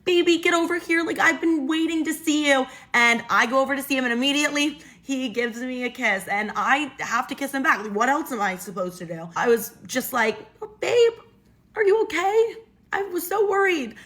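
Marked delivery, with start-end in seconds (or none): 0.0-2.7 lightly acted eager boyfriend, pitched up; eager greeting. Boyfriend lighting up as he sees his girlfriend, eager greeting. 10.6-14.0 put-on high-pitched doting girlfriend; feigned concern. Ex pretending to still be his girlfriend, concerned.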